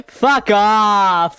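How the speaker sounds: loud high yell. Real, not acted. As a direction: shout it back at them. Shouted playful reply.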